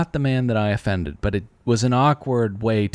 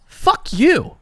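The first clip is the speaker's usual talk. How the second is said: mock angry retort; 4 dB louder than usual. Retorting to imagined cashier.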